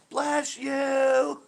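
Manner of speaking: high, loud drawn-out call; distant neighbor's shout. Acted. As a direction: holler it from blocks away. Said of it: "all of a sudden I'll hear a".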